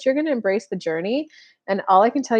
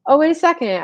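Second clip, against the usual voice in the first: sudden realisation; 8 semitones higher, 5 dB louder than usual. Retold or imitated. Sudden realisation in imagined thought.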